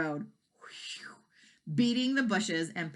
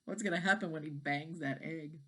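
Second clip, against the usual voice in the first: plain reading voice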